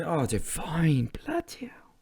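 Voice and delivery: slow whispered "fine", then a whispered curse; whispered frustration; disbelieving whisper. Real, not acted. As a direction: breathe it out in disbelief. Muttering in disbelief.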